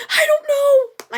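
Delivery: high, loud, slow sob; overwhelmed girl crying to the 911 dispatcher; crying helplessly. Retold or imitated. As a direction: wail it out through tears.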